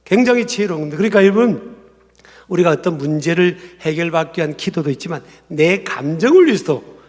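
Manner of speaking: normal talking voice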